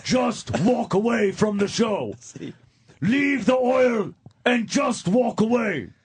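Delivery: slow, high, drawn-out proclamation; Road Warrior warlord. Acted. Quoting the film villain's ultimatum, slow warlord voice. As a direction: stretch it out like a movie warlord addressing crowds. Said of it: "quotes from that fucking shitty movie".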